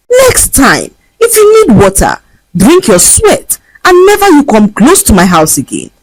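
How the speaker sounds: high, sharp telling-off; scolding. A narrator's reading. Rich girl scolding the old beggar woman, high and cutting. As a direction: give the beggar a piece of her mind. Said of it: "gave the woman a piece of her mind".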